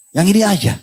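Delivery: put-on a touch of a small kid pointing; child's choice. Child voiced picking school. (suara anak kecil yang ringan)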